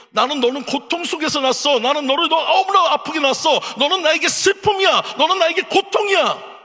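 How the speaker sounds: high, loud, anguished mother's voice; mother's lament. Acted. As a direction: cry out as a mother blaming her son. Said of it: "부를 때마다 뭐라고 하는 거예요".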